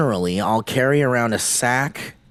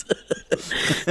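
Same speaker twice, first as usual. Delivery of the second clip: goblin cackle; 6 dB quieter than usual. Retold or imitated. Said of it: "laughing maniacally".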